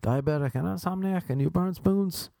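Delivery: high-pitched, marvelling disbelief; mock-amazed, voice climbing; naive amazement. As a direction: play innocently astonished.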